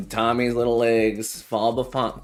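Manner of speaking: normal talking voice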